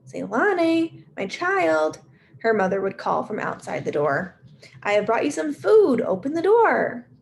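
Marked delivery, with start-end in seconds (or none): 0.1-2.0 high whispered call; sweet high mother. Acted. Mother calling her daughter from outside the door, sweet high call. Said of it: "call out in her sweet high voice". 4.8-7.1 high, louder, sweet calling voice; sweet high mother. Acted. Mother at the door bringing food, high sweet voice. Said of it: "call out in her sweet high voice".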